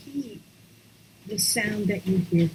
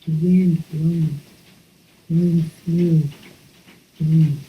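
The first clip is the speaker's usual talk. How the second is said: evocative wind; 3 semitones lower, 5 dB louder than usual. Acted. Teacher evoking the wind during the exhale. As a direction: let the words blow like wind.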